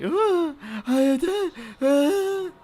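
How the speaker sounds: high squealy mom coo, far above his own; cooing like a delighted mom; mock baby-talk mom; happy mom voice.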